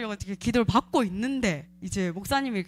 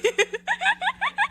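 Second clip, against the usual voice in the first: high laugh. Real, not acted. Laughing at the exposed demon.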